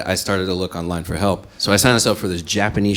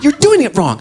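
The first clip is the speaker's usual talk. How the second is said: much higher and louder, shrill nag; scolding; 10 dB louder than usual. Retold or imitated. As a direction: snap it at him. Girlfriend scolding in quarrel.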